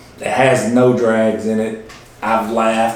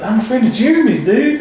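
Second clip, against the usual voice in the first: higher, quick, eager voice; imitating the guest; 12 semitones higher, 4 dB louder than usual. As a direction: pitch it up fast like a nervous party guest. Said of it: "He goes, he goes".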